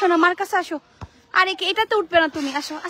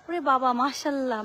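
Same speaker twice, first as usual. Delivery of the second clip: ordinary talk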